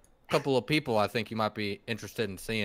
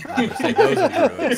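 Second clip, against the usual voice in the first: laughing; 11 dB louder than usual. Real, not acted. Laughing at his own joke.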